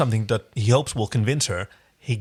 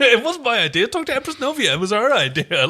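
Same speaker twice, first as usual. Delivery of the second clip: higher, laughing put-on weaselly voice; laughing Rigel impression; 10 semitones higher than usual. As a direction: pass the blame off with a giggle. Voicing Rigel shifting blame, laughing.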